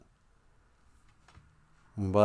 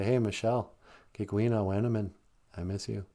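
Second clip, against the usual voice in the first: soft imitated call; 4 semitones lower, 9 dB quieter than usual.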